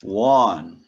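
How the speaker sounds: loud "One" said as "wun"; model pronunciation. Shown as a demonstration. Teacher models "one" loudly for students, W glide. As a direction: punch the W at the start of "One". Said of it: "olha a pronúncia".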